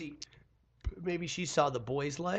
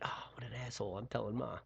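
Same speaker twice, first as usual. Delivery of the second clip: low, very soft indignant aside; muttered tattling; 4 semitones lower, 13 dB quieter, faster than usual. Retold or imitated. Sister finds out and plans to tell Ma, muttered tattling.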